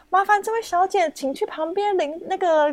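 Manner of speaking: lightly voiced host sending the winner to pay; a hint of the auctioneer giving directions; auctioneer instructing. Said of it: "主持人说".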